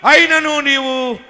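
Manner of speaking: slow thundering proclamation; booming divine promise. Acted. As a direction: proclaim God's reassurance with full force. Preacher voicing God's promise.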